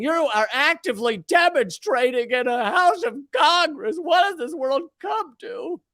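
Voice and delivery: lightly voiced bullhorn cop; imitating a megaphone. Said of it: "the cop is a bullhorn".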